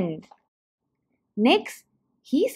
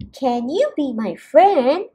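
hopeful little fish voice; 2 semitones higher, 5 dB louder than usual. Acted. Fish asks crab.

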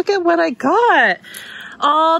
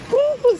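woo call; 11 semitones higher than usual. Retold or imitated. Imitating the whistling again.

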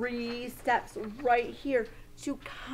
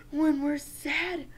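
high mock-tearful voice; acted sadness; 4 semitones higher than usual. Teacher showing children another feeling, mock sadness. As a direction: sniffle into it, pretend sad.

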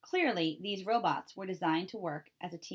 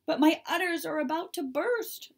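much higher, loud distressed cow cry; 10 semitones higher, 6 dB louder than usual. Acted. Tess the cow protesting to Roz, high loud cry. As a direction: wail it as a desperate cow. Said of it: "cried Tess".

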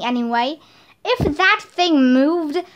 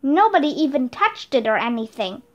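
ordinary delivery

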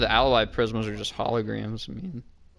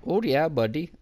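higher-pitched fake Canadian accent, rising; botched Canadian accent; 5 semitones higher than usual. Joking back to a teammate, botched Canadian accent. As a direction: try Canadian and land on bad Indian. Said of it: "it turned into a shitty Indian accent".

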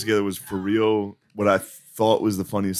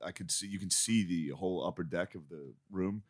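ordinary talk, nothing marked

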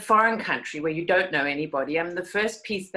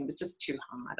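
whispered, almost to herself; whispered aside; 6 semitones higher, 12 dB quieter than usual. Real, not acted. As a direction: breathe the line out softly.

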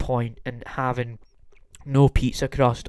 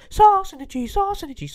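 much higher squeaky falsetto, mocking; mock squeaky repeat. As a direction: squeak it out like a cartoon mouse. Mocking the plain pizza toppings.

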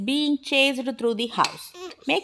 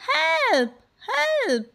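high-pitched cry for help; storybook mum squealing for help; mock scream; 14 semitones higher than usual. A narrator's reading. Mum cries out at the possum, mock scream. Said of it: "screamed Mom".

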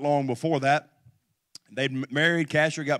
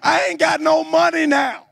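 high, loud mock complaints from the congregation; hammed-up objector pleading; whiny excuses; 13 semitones higher, 8 dB louder than usual. Pastor mocking churchgoers' excuses, loud and whiny. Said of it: "You say".

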